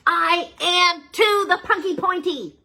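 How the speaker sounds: high, slow, loud pouty insistence; villain insisting on his title; indignant rat. Acted. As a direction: stamp your foot in protest. Whiskerface insisting.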